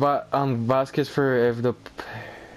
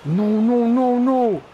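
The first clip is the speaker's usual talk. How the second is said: higher pleading nos; anguished pleading; 5 semitones higher than usual. Real, not acted. Gamer pleading with the match in the last seconds. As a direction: beg the game not to end. Game ending in defeat.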